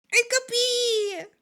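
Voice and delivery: high, slow sing-song hey; hammed-up cheerful hello; playful greeting. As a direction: call it out like greeting a pet.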